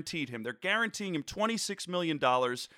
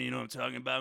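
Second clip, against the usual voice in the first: lower, softer laid-back dude; Foxy echoing the host, low and quiet; lightly voiced chill guy; 6 semitones lower, 5 dB quieter, much faster than usual. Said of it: "I wanna introduce you to Foxy".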